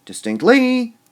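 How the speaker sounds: distinctLY, the LY high, loud and held; overdone final LY on distinctly; stress on last syllable. Shown as a demonstration. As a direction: wrongly punch the end of distinctly. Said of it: "Would we say distinctly?".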